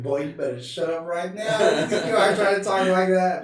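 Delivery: rising mock outrage; imitated scolding wife. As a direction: scold him as his wife would, half laughing. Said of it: "My wife would be like".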